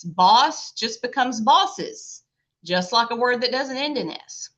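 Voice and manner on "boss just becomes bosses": slow demo. Shown as a demonstration. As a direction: Boss and bosses spelled out slowly, each word drawn out. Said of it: "add an apostrophe S".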